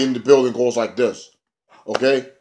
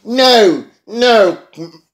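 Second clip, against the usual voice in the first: higher, slower, whiny retelling of Spud; imitating Spud higher and slower; high mock Spud voice; 6 semitones higher, 5 dB louder, slower than usual. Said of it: "And Spud's like".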